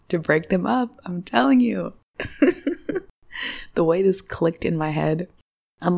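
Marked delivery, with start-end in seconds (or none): 2.2-3.0 very high giggle; high squeal of laughter. Real, not acted. Host convinced of her theory, high squealing laugh.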